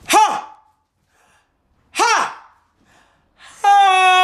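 high, loud panted ha; sharp ha bursts. Shown as a demonstration. Coach calling short ha sounds for breath drills. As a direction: punch out each ha from the belly. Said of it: "Practice saying ha while you're doing it".

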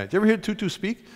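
normal talking voice

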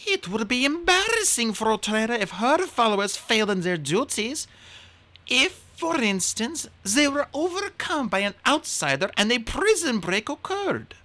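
a queen hinting with a wink; the queen slyly suggesting a prison break to Kinsey; scheming queen. Acted.

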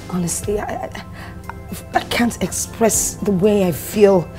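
soft, low shamed apology; barely able to say it; ashamed. Acted.

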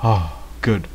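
normal talking voice

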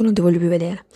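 normal talking voice

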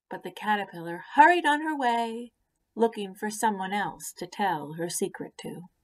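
ordinary delivery, nothing marked

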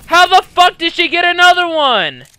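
loud, high angry question; indignant yell at the game; outraged disbelief. Real, not acted. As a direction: shout it high until the last word drops. Outraged at opponent's luck.

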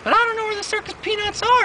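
very high whiny falsetto; girlfriend's voice. Retold or imitated. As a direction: squeak it helplessly like her girlfriend. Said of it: "She's like".

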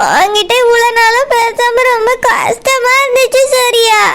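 slow, drawn-out tearful wail; crying while talking. Acted. Girl telling her boyfriend how hard not talking was, crying. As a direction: sob through the words, stretching them out. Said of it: "அழாம பேசு".

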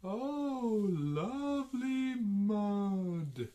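high, loud cow voice, stretched out slowly; cow character. A narrator's reading. Storyteller voices the cow delighting in mud, high cow voice. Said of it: "says the cow".

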